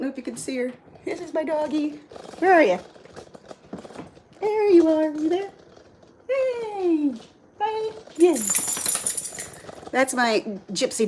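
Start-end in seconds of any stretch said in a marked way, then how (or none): 2.4-8.7 higher, sing-song voice to a pet; looking for the dog and greeting her; talking to the dog. Real, not acted. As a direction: coo at a dog that's hiding.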